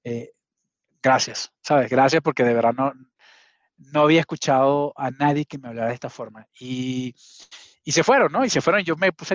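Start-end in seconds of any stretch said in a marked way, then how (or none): none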